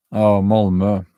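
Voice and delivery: normal talking voice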